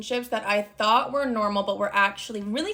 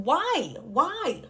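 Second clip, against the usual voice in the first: exasperated plea; 5 semitones higher than usual. Retold or imitated. Ex insists on telling her.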